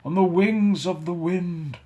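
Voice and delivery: mournful ghost. Acted. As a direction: grieve for the wasted life. Ghost answering.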